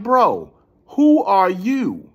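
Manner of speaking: high, drawn-out, loud exasperation; acted-out exasperated challenge; exasperated demand. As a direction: stretch it out, incredulous at this stranger. Retelling his demand to a stranger.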